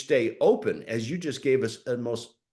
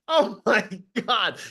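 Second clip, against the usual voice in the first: stunned disbelief; 8 semitones higher, 3 dB louder than usual. Retold or imitated. Narrator reacting in disbelief.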